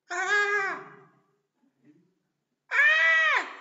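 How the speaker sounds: loud, high-pitched mock scream; demonstrated fear scream. Shown as a demonstration. Preacher shows how frightened disciples cried out, screaming. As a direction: scream "ah!" as if terrified. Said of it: "¿Cuáles son las voces de miedo?".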